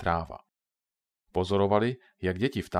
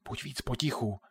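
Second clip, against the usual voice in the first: hushed scolding, much softer; soft whispered telling-off; 4 semitones higher, 6 dB quieter than usual. A narrator's reading. Katka, hiding, hushes Ondra in a soft reproving whisper. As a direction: shush him without being heard. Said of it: "napomenula ho šeptem Katka".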